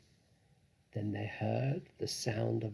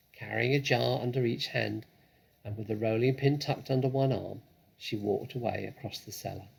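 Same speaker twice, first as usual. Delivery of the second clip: plain reading voice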